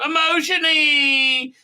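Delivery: high, slow sing-song robot chant; mock bots. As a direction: drag out "Emotion-y" like goofy bots. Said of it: "the bots go".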